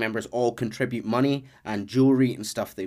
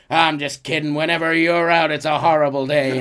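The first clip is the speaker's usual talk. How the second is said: mock rude twist; 3 semitones higher, 6 dB louder than usual. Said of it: "he goes".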